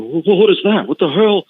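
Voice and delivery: high-pitched imitation of Al Snow in a mock Southern accent. Wrestler recalls a puzzled colleague, in a mock Southern accent. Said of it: "I don't know why Al Snow's a Southern".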